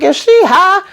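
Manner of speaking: high-pitched incredulous question; acted disbelief. Victoria asking about Lily's state, high incredulous voice. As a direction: shoot way up in mock astonishment.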